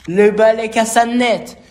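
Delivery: high, loud burst of alarm; dramatic exclamation. A narrator's reading. Narrator to children as the broom breaks, dramatic. Broom suddenly snaps in story.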